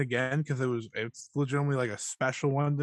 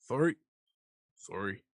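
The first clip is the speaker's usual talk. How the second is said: put-on Canadian accent; 3 semitones lower, 5 dB quieter than usual. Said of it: "You doing a Canadian accent?".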